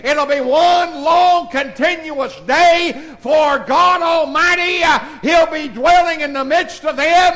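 loud, high, slow preaching cadence with "uh" breaths; full-force preaching. Acted. Pastor lifting the congregation toward heaven, full-force preaching. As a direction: preach it out to the rafters, drawn out.

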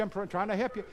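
ordinary delivery

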